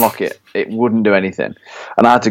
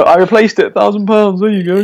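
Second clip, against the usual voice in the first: higher, breezy mock mechanic; lightly voiced smug mechanic; 10 semitones higher than usual. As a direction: play the cheeky mechanic cashing in. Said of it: "he's gonna go".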